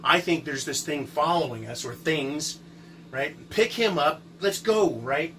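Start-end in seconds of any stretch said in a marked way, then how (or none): 3.5-4.9 higher, punchy hurry-up; urgent retold command. Storyteller retelling the escape after friend hits tree, urgent. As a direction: act out getting a fallen friend moving.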